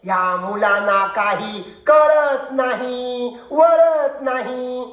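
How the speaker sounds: slow, drawn-out lament over the pupils; schoolmaster's long-drawn catchphrase; exasperated teacher. Acted. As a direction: stretch each phrase out in weary exasperation.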